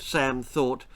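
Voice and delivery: plain reading voice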